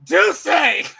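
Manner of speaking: high, loud yell mimicking a friend's order; imitated yell. Friend calling out the liquor order, imitated yell. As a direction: yell it out like an excited bar order. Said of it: "Jason just yells out".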